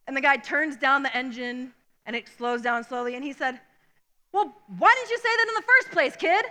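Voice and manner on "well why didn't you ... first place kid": higher, exasperated give-in; grudging driver. Retold or imitated. Driver backing down to boy, exasperated higher voice. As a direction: concede with a huff.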